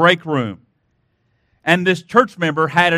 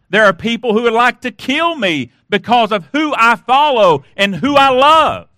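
raised pitch, building to a forceful peak; impassioned preaching; 4 semitones higher than usual. Real, not acted. Preacher telling congregation people hate him for who he follows, impassioned. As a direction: preach it higher and harder toward the last words.

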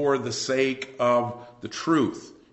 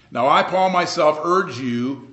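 louder, higher urging, drawn out at the end; urgent plea; 4 semitones higher, 7 dB louder than usual. Acted. Paul pleading with the Corinthians, urgent. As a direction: press the appeal on them, raised voice. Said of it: "an urgent but yet gentle plea".

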